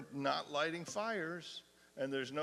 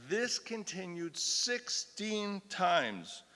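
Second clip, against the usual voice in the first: slow, grave; 5 semitones higher, 3 dB louder, slower than usual. Acted. Grave ending of the reading.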